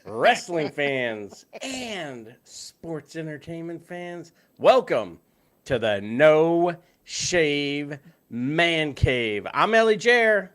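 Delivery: high, loud, slow arena announcer; milk every word like a ringmaster. Pretend. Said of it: "better than my intro".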